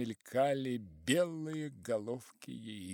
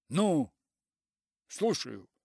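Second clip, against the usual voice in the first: curt disdain, loud at the start; disdainful; 5 semitones higher, 9 dB louder, slower than usual. A narrator's reading. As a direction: answer the phone with distaste. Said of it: "брезгливо спросил Персиков".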